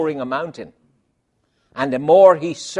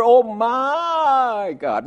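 slow, stretched "Oh my God"; drawn-out exclamation; 6 semitones higher, much slower than usual. Retold or imitated. People in a predicament exclaiming, slow and stretched. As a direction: draw it out in dismay. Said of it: "What do they say?".

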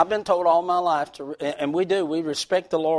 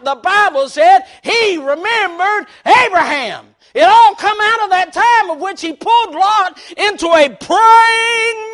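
preaching; 12 semitones higher, 13 dB louder than usual. Acted. Preaching at full force.

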